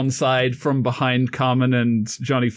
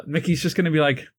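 normal talking voice

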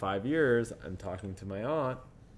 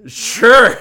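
very loud, high "sure"; hammed-up loud agreement; retold eager agreement; 12 semitones higher, 21 dB louder than usual. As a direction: jump on the excuse, loudly. Seizing the cops' mistaken version.

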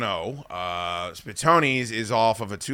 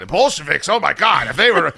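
louder, higher fan ramble; Adam; 4 semitones higher, 5 dB louder than usual. Retold or imitated. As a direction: cut in as an excited wrestling fan. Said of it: "Adam's still talking about".

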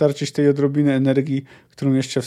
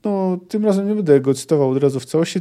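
usual speaking voice

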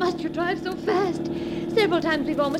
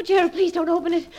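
ordinary talk

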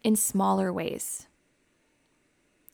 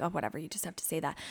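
quick, softer brush-off; eye-rolling dismissal; 4 semitones lower, 8 dB quieter, much faster than usual. Retold or imitated. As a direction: wave it away like you don't believe it. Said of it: "roll our eyes and be like".

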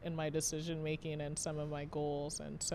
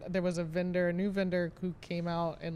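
usual speaking voice

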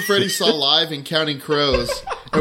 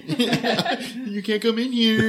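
laughing; 6 semitones higher, much slower than usual. Retold or imitated. Mocking bathroom occupant, laughing.

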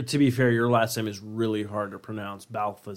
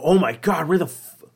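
hammed-up panic, higher and louder; fake panic; 4 semitones higher, 5 dB louder than usual. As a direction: pretend to freak out about losing the badge. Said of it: "just start panicking".

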